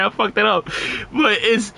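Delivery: ordinary delivery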